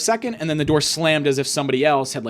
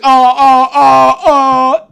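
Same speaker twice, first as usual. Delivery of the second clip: loud high orgasmic "oh"s; hammed-up sex moaning, high and loud; mock climax moans; 11 semitones higher, 14 dB louder, much slower than usual. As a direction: wail each oh like a climax. Imitates man climaxing.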